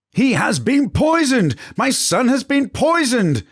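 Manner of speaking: queen shrieking in panic for her son; a mother screaming her son was poisoned; screeched, madly. Acted. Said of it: "the queen screeched madly".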